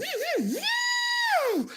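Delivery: high, sustained mock dental drill whine; a drill noise held high and steady; dentist drill impression. Shown as a demonstration. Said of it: "that impression though".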